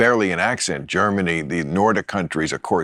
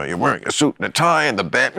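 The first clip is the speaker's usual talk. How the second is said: usual speaking voice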